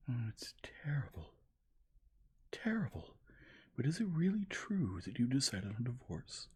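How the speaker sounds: high, stricken lament; shaken dismay. A narrator's reading. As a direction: react in horror. Dolly shaken.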